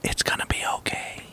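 whispered, fake-soothing consolation; mock comforting whisper. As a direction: whisper it like calming a crying child, half joking. Mock comforting the crying haters.

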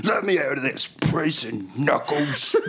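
put-on Cockney accent. Paddington voiced in Cockney.

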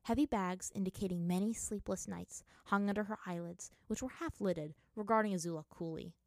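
usual speaking voice

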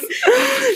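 high-pitched burst of laughter. Real, not acted. Host cracking up at the co-host, laughter. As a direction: crack up helplessly.

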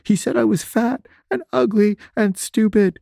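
high-pitched mock wailing; crying. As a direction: imitate someone wailing the words through tears. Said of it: "she was, she was crying".

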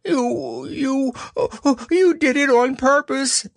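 high, spluttering Piglet protest. A narrator's reading. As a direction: stammer out the accusation at a squeal. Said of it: "spluttered Piglet".

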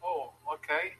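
normal talking voice

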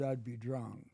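ordinary delivery

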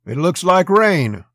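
loud, high, drawn-out derision; derisive. A narrator's reading. Rigdon grins at the barkeep and answers derisively. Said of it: "he said derisively".

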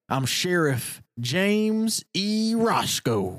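slow proud sheriff intro; slow drawn-out introduction. Acted. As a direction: announce the name slowly. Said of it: "So it's like".

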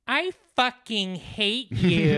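high, drawn-out cat pronouncement; talking cat. Acted. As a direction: draw out every word as a spiteful cat. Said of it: "your cat looks up at you and goes".

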